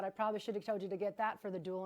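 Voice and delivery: ordinary talk, nothing marked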